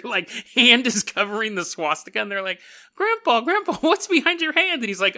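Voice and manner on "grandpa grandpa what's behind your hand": put-on much higher-pitched child voice; curious kid. Grandchildren asking grandpa about his certificate, high kid voice. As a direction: pipe up like an inquisitive grandchild. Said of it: "he's telling his kids that story".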